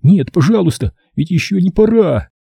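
high-pitched, desperate inner plea; horrified pleading. A narrator's reading. Boy silently pleads as his father dies, desperate and afraid. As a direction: beg for it not to be time. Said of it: "в ужасе подумал он".

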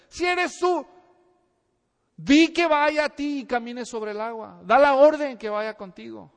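loud pleading call; shouting. Retold or imitated. Peter calling to Jesus from the boat, loud. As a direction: shout the request across the waves. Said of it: "le grita Pedro".

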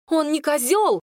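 high, mild mock yell; indignant yell. A narrator's reading. Two girls defend Kirush at once, high indignant cry. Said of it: "заорали мы с Клодиной в один голос".

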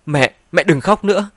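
high, loud emotional plea; choked-up. A narrator's reading. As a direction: plead through a lump in the throat. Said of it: "Giọng nói nghẹn ngào".

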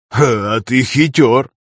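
slow, amused laughing remark. Acted. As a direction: chuckle it out slowly. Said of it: "захохотал Дарий".

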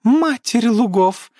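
high, slow, exasperated elf cry; theatrical exasperation. A narrator's reading. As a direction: groan it out theatrically. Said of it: "картинно закатил глаза".

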